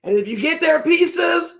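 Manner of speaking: normal talking voice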